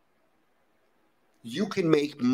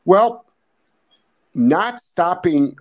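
emphatic; 3 semitones higher, 7 dB louder, much slower than usual. Real, not acted. Emphatic answer to question.

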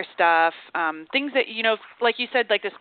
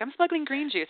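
higher, playful put-on voice saying the line; mock proud confession; 8 semitones higher than usual. Host teasing the guest about smuggling juice, playfully higher. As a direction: tease her by voicing her in a higher sing-song. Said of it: "You're like".

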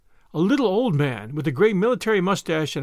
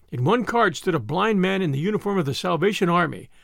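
usual speaking voice

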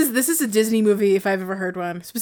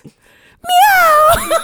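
loud cat meow; 8 dB louder than usual. Retold or imitated. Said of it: "in your ear goes".

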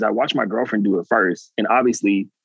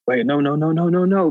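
rising, higher pleading "no, no, no"; mock panic; 6 semitones higher than usual. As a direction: push back in mounting alarm. Retold panic on rocket ship.

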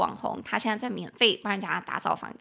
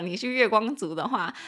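normal talking voice

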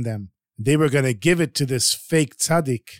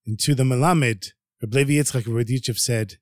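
usual speaking voice

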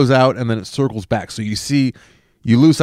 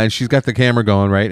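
ordinary talk, nothing marked

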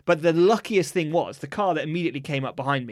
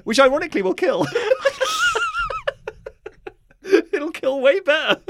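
laughing; 4 dB louder than usual. Real, not acted. Laughing at own joke.

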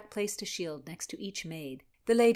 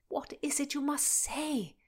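imploring; 8 semitones higher than usual. Acted. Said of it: "the lad implored".

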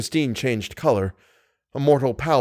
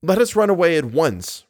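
urgent plea at raised pitch; insistent; 6 semitones higher, 3 dB louder than usual. A narrator's reading. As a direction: press her firmly to flee. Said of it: "Raoul insisted once more".

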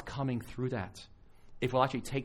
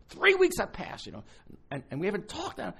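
retold anger; 10 semitones higher, 4 dB louder than usual. Woman quoted, angry again.

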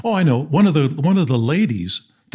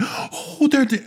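gasped mock delight; imitated delighted opening-up; 14 semitones higher, slower than usual. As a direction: imitate someone suddenly lighting up with a gasp. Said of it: "she just opened up".

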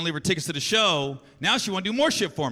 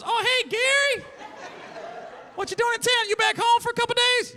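high lady voice; 14 semitones higher than usual. Retold or imitated. Greeting Gary at the rental counter, high sweet voice. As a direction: play the friendly rental clerk, lifting way up. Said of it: "She goes".

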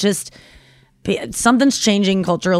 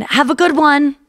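fake friendly; 7 semitones higher than usual. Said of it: "they don't know that you mean death".

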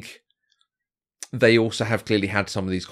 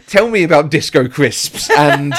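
laughing; 6 semitones higher, 7 dB louder, slower than usual. Real, not acted. Teasing co-host, laughing.

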